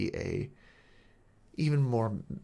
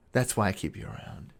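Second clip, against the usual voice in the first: ordinary talk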